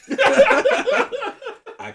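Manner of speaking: slow, loud, laughing line. Real, not acted. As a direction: drag it out while laughing.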